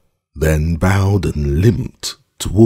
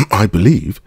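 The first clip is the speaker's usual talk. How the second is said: hesitant; 5 semitones higher, 4 dB louder than usual. A narrator's reading. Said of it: "she answered hesitantly".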